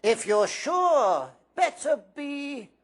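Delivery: slow, teasing hat voice rising to a call; muse, then decide; sorting hat weighing Harry, then deciding; small voice of the hat. A narrator's reading. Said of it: "said the small voice".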